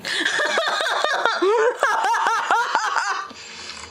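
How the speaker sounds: high burst of laughter; laughing hard. Real, not acted. Reacts to old voice clip.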